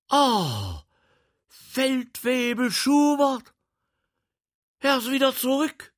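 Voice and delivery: put-on glad high greeting of an old soldier; freudiger Ausruf. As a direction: call out his name, delighted and loud. Prince greets sergeant gladly. (freudig überraschter Ausruf)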